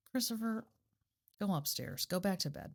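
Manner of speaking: quietly ordering son to bed; hushed shooing. Real, not acted. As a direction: send him off without raising your voice.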